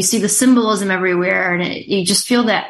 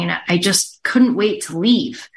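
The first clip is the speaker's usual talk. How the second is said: usual speaking voice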